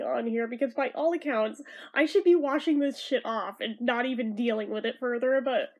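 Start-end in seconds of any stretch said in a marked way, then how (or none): none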